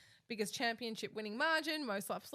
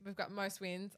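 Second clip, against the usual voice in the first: ordinary talk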